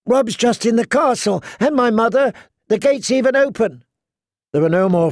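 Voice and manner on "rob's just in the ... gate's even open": put-on much higher, thin frightened girl's voice; scared little girl. A narrator's reading. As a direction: plead like a scared child. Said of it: "Her voice sounded thin and scared, a little girl's voice".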